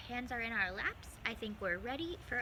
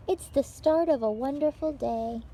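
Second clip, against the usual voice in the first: bright, lively read-aloud for little listeners; picture-book reading; 4 semitones higher, 9 dB louder than usual. Acted. Librarian reading a picture book to children, lively read-aloud. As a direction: tell it like story time for small children.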